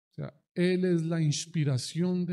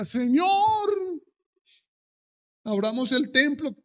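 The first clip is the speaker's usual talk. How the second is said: much higher, loud cry to the Lord; shouted plea; 9 semitones higher, 4 dB louder than usual. Retold or imitated. Pastor before cameras begging God, shouting plea. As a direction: call out to God urgently. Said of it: "uno decía".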